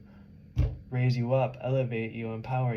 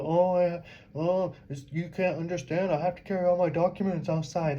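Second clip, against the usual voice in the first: higher-pitched mock whining quote; mocking complainers; 5 semitones higher than usual. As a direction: mimic other people's complaints, pitched up. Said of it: "Everybody talking about".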